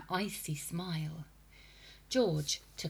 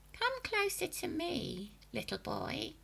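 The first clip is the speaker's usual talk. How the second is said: coaxing witch voice; 11 semitones higher than usual. A narrator's reading. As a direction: lure him closer, sweetly sinister.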